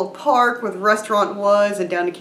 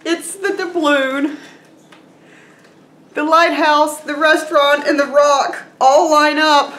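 high excitement, building louder item by item; thrilled reveal; 7 semitones higher, 4 dB louder than usual. Real, not acted. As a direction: list them in mounting, high-pitched delight. Revealing the beloved printed gift.

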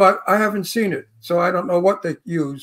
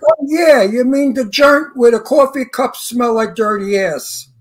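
Brooklyn tough guy; 2 semitones higher than usual. Retold or imitated. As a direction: lean into thick Brooklyn, slow. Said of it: "let me give you the whole line".

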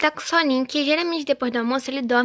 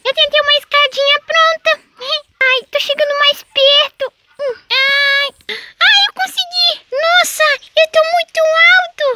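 put-on high little boy voice, climbing then panicking; boy calls mom and grandma from the tree; scared child; 8 dB louder than usual. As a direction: kid stuck up a tree crying for help.

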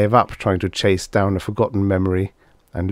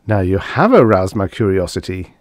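ordinary delivery, nothing marked

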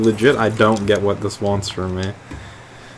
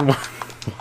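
soft unvoiced giggle; a quick airy laugh at the chase; breathy giggle; 5 semitones higher, 6 dB quieter than usual. Real, not acted. Gamer laughs while failing to catch a fish, airy giggle.

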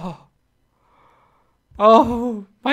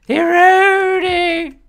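drawn-out high call; 7 semitones higher, 4 dB louder, slower than usual. Shown as a demonstration.

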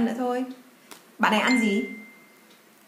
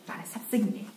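whispering; 8 dB quieter, faster than usual. Real, not acted. Soft aside to child.

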